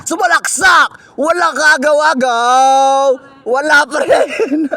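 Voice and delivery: loud, slow, drawn-out call of the miss; excited commentator shout. Acted. Kid commentator yelling as the drive is blocked, loud and excited. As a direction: belt it out like a courtside announcer.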